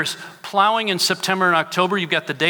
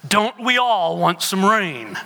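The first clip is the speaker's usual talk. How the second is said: a touch of a gruff butcher, lightly snarled; grumpy butcher snapping back at a customer, lightly mocked; mild mock snarl; 4 semitones higher than usual. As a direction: imitate a grumpy butcher, half-hearted. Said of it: "he snarled at her and said".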